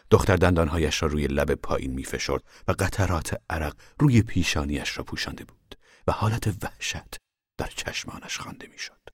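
ordinary delivery

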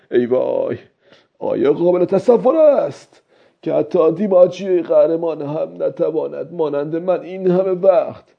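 put-on high-pitched old fisherman exclaiming to himself; speaking out loud. A narrator's reading. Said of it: "با صدای بلند گفت".

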